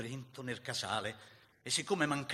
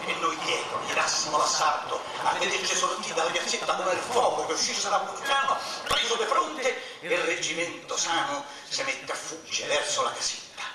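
loud, raised, surging recitation; heated charge narration; 5 semitones higher, 9 dB louder than usual. A narrator's reading. Narrator telling of the assault on the regiment, loud and surging. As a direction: drive the story forward like the charge.

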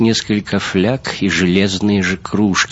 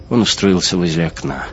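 ordinary delivery, nothing marked

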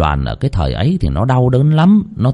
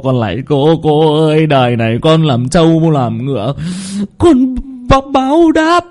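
much higher, stammering begging; stammering plea for mercy; 7 semitones higher than usual. Acted. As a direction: beg for your life, voice breaking. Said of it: "lắp bắp". (giọng lắp bắp van xin thảm thiết)

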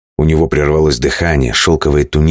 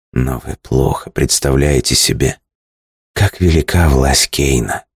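low chesty voice. Acted. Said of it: "низким грудным голосом".

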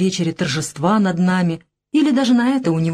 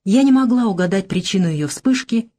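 ordinary delivery, nothing marked

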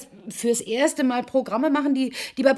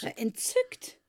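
incredulous scoff; 4 dB quieter, slower than usual. Acted. Mocking his word.

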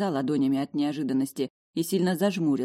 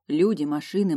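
plain reading voice

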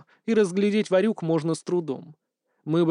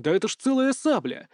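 usual speaking voice

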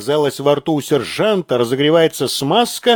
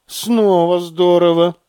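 usual speaking voice

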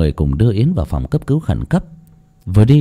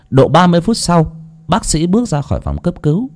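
ordinary delivery

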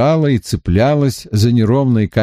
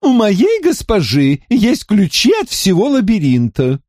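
character voice; 10 semitones higher than usual. A narrator's reading.

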